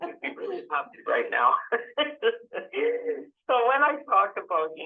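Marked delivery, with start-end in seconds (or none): 1.6-3.2 short high burst of laughter; real laugh. Real, not acted. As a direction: laugh at her own joke about the students. Jokes about last slide.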